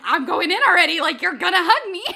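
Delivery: loud, high mock-forceful shout; comic insistence. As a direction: bulldoze ahead, shouting in mock determination. Insisting on hug, comic re-enactment.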